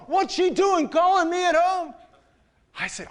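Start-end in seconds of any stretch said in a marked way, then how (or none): 0.1-1.9 high, louder indignant question; upset boss. Retold or imitated. Director complains she calls him at home, high and outraged. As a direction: sound outraged and baffled. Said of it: "He's all upset".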